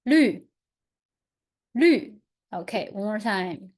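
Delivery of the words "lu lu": "Lu" falling sharply and louder; fourth tone lu. Shown as a demonstration. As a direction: punch "Lu" down from high, fourth tone. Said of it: "And the last tone?".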